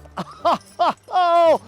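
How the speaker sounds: loud, high burst of laughter. Real, not acted. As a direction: laugh out at the irony. Laughs at locks arriving late.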